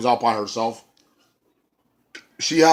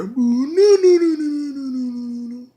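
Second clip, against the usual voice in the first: high, wailing mock boos; imitated wailing crowd; 14 semitones higher than usual. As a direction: wail like a crowd booing. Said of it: "And then you hear".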